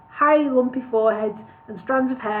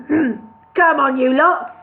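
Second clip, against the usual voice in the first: loud, higher shout; 3 semitones higher, 5 dB louder, slower than usual. A narrator's reading. Boss shouting at the dwarfs to start, loud. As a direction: rouse the table with a shout. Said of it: "then shouted Al".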